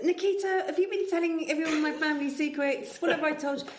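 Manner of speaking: high, loud scolding yell at the cat; mock shouting at the cat. Wife imitates him shouting at the cat about secrets, high and loud. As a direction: playfully shout him down for spilling the family secrets. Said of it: "You were shouting to Nikita".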